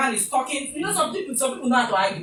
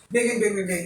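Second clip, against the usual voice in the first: mock music beat; 4 semitones lower than usual. Imitating movie music.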